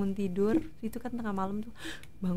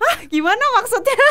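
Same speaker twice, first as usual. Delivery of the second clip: much higher, louder startled question breaking into a laugh; startled disbelief; 13 semitones higher, 7 dB louder than usual. Retold or imitated. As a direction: jolt up in disbelief, then laugh. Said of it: "Mbak Ute itu katanya lagi tiduran sampai bangun".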